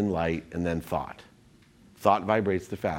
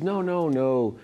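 lightly voiced doctor, pitched up; imitated doctor; 6 semitones higher, slower than usual. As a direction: imitate her objection in a higher voice. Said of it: "She goes".

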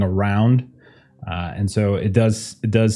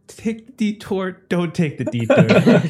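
high, slow, breaking into a laugh; amused exasperation; 9 semitones higher than usual. Real, not acted. Game master to player on the two options, laughing exasperation. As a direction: spell out the choice slowly in a high voice.

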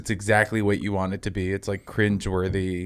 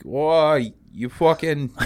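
bust out a Boston-style Maine accent, more colonial; 6 semitones higher, much slower than usual. Shown as a demonstration. Comic showing friends a Maine accent, mock Maine voice. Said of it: "it's like a Boston accent".

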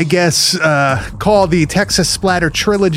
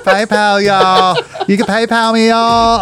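higher, drawling mock Southern pitch; Southern accent; 4 semitones higher than usual. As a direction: plug it in a hammed-up Southern twang. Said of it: "you saying PayPal with that accent".

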